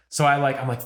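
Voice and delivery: ordinary talk, nothing marked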